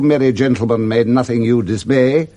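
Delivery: ordinary talk, nothing marked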